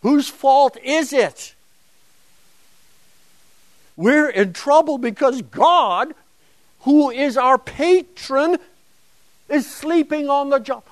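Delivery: accusing tone of voice. Acted. Lecturer acting out the people blaming God, loud accusation. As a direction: voice the aggrieved people laying blame. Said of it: "It's the tone of voice".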